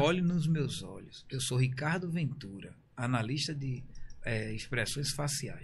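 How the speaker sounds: slow, low, hushed interrogator voice; mock expert analyst. Host poses as a facial expressions analyst to a guest, slow and low. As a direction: play a grave analyst, very slow.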